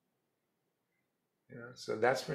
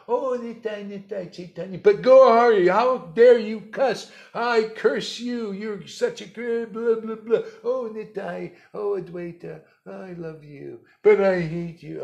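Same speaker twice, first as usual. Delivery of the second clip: mock hypocrite devotee; 8 semitones higher, 13 dB louder than usual. Guru parodying a devotee shocked by cussing, high and very loud. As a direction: play a preachy devotee who prays and curses in one breath.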